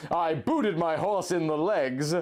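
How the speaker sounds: ordinary talk, nothing marked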